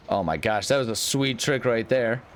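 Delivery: ordinary talk, nothing marked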